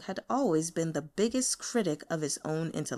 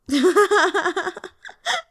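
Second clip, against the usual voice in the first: high, loud burst of laughter; 10 semitones higher, 7 dB louder than usual. Acted. Colleague bursting out laughing at the boss, loud laugh. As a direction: crack up out loud. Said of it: "He laughed out loud".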